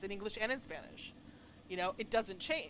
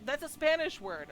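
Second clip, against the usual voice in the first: mock indignant student; 7 semitones higher, 6 dB louder than usual. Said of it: "And he's like going".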